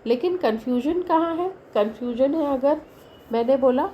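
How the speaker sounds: usual speaking voice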